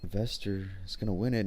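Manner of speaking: ordinary delivery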